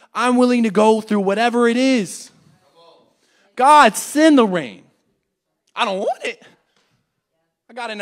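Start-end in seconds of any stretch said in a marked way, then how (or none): 3.5-4.8 loud, slow, defiant call; bold prayer. Acted. Preacher challenging God for trials, loud slow call. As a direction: shout it up to the sky, slowly.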